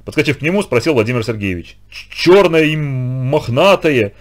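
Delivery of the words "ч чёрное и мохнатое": very slow, stammering, terrified; barely squeezed out. Acted. Lyoshka stammering to the teacher about the creature, terrified. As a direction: force the words out through fear. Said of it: "еле выдавил из себя Лёшка".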